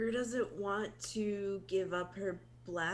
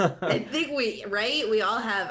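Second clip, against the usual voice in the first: laughing; 3 semitones higher, 6 dB louder than usual. Real, not acted. Player laughing in agreement.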